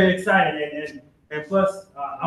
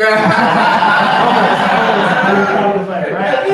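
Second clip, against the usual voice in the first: laughing through the words, higher and louder; 5 semitones higher, 8 dB louder, much slower than usual. Real, not acted. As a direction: chuckle out the correction. Laughing at his own joke.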